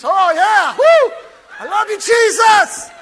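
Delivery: high, loud, ecstatic cry to Jesus; ecstatic shout. Retold or imitated. Worshipper overcome in praise, shouting. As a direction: erupt with joy. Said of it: "You're like".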